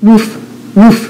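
short, lightly voiced woofs; dog bark. A narrator's reading. Dog calling to the spider, mild barking. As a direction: woof like a storybook dog, mildly. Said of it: "barked the dog".

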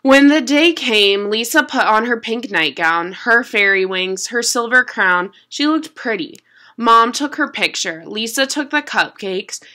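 usual speaking voice

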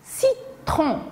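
"citron" split into ci, tron; two crisp beats on "citron"; syllable by syllable. Shown as a demonstration. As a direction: say "citron" with a short gap in the middle. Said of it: "Combien y a-t-il de syllabes".

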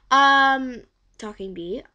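normal talking voice

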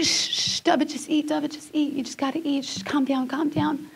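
soft, urgent shushing; hushing a baby. Retold or imitated. Crying mother tries to feed her baby, retold soft hushing. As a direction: hush him quietly, repeating to soothe. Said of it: "I'm telling him to calm down".

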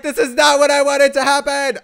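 loud laughing complaint; laughing Santa. Retold or imitated. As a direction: laugh through Santa's dismay. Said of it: "Santa's like".

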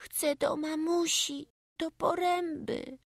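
put-on very high, slow little-boy voice; high, drawn-out child's confession; stammered small plea. A narrator's reading. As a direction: plead like a small homesick child. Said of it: "najcichszym szeptem wyjąkał".

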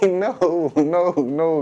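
high, loud laughing no's; laughing protest. Retold or imitated. To grandpa, laughing loud denial about beaches.

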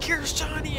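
light, high-pitched film impression; mock movie catchphrase. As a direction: call it in a playful high voice. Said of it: "I was gonna be like".

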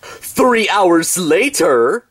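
drawn-out announcer reading a time skip; slow title card. Acted. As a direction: stretch each word like a caption.

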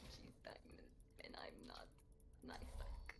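whispered, self-mocking aside; whispering. Real, not acted. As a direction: whisper it like a confession.

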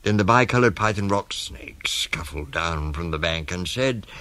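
ordinary delivery, nothing marked

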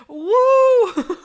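loud, high whooping cheer; excited cheer. Real, not acted. Loud high cheer for the chat's record. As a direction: celebrate the record at the top of the voice.

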